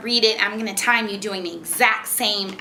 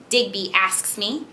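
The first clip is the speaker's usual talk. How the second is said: ordinary talk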